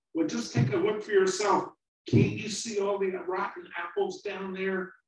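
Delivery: put-on high, loud, worried young voice; a small fruit pleading not to fall; little apple, scared. Small apple begging the older one, high and loud.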